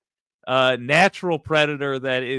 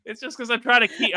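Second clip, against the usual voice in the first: normal talking voice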